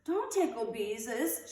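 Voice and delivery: put-on slow, high pleading child; begging. A narrator's reading. Ramona pleading with her sister, begging. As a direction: beg her not to. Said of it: "she begged".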